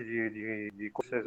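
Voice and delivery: audio freezing mid-sentence; choppy lagging call audio; buffering stutter. Real, not acted. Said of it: "ficou um te-, um tempo parado aí".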